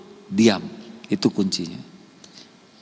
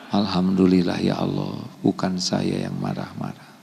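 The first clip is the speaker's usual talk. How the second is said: quiet thankful wife; 5 semitones lower, 8 dB quieter than usual. Retold or imitated. Istri bersyukur pelan.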